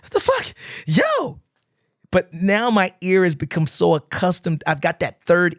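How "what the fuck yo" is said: mock disbelief. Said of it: "And I'd be like".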